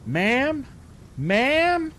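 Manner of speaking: high, loud call across the lot; yelling. Retold or imitated. Bystander yelling to a driver, loud high call. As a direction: call out sharply to stop the van. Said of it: "the lady that's yelling".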